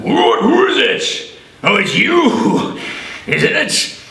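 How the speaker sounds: lightly voiced cross devil; grumpy devil. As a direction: snap out from behind the hatch. Devil answers at hell's hatch.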